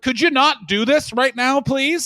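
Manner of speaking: loud, high, exasperated request; mock exasperated plea. American government to the drunken fort squatters, exasperated plea. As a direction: plead with them in mock exasperation.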